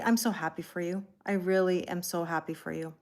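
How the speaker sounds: normal talking voice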